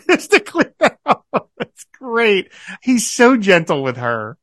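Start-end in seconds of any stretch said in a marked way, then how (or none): 0.1-1.3 laughing while repeating. Real, not acted. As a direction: say it through laughter. Echoing with a laugh.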